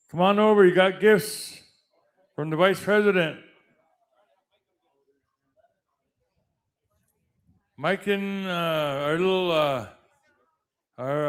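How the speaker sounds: announcer introducing names; powwow emcee. Acted. Introducing committee members.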